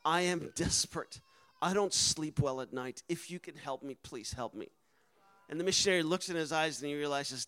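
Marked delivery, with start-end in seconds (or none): 0.0-4.7 much softer and lower, pleading; a hushed, desperate plea; mock whisper. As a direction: lean in and beg under your breath. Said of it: "whispers in the missionary's ear".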